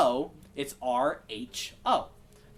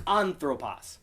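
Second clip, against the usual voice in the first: ANthropos, weight on the "an"; stress on first syllable; slower than usual. Shown as a demonstration. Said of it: "that acute is on that first syllable".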